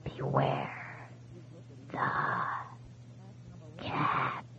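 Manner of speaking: faint whisper as if from far away; barely reach across the line; very distant voice. A narrator's reading. Ghost's last warning comes from far away, faint whisper. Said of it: "The voice was so distant now".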